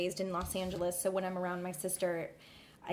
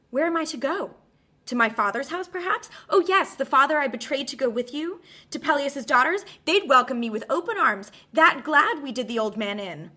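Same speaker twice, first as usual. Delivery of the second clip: louder, higher sarcastic outcry; bitter sarcasm; 6 semitones higher, 8 dB louder than usual. Acted. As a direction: spit the irony at him. Bitter rhetorical questions, peak.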